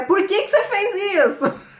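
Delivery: high, loud half-laughing scold; mock scolding mother. Mother scolding kids when it's serious, high mock scold. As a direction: play the cross mother, laughing through it.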